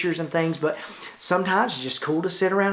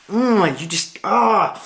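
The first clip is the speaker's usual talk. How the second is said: higher, drawn-out adoring groans; playful swooning; 4 semitones higher, 3 dB louder, much slower than usual. Pretend. Vlogger addressing a beloved camera, playful swooning. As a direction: groan with exaggerated delight.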